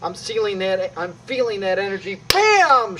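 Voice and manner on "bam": much higher, loud bam; sudden loud bam; loud burst. Shown as a demonstration. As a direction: blurt it out like the hit lands. Demo strike feeling energy.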